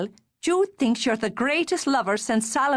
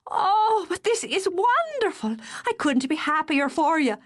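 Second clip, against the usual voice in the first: high, bright delighted gush; delighted sister; 7 semitones higher than usual. Acted. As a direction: burst with joy for your brother. Darcy thrilled at the news.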